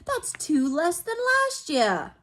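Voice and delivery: high, slow, sulky complaint; whiny spoiled boy. A narrator's reading. Dudley sulking to his parents, high slow whine. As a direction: whine it out like a brat.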